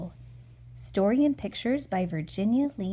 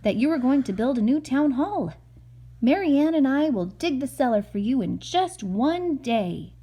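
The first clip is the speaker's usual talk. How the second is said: louder, confident boast to the selectman; a hint of a proud workman; proud Mike; 5 semitones higher, 7 dB louder than usual. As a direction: brag you can dig it in a day. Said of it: "he said".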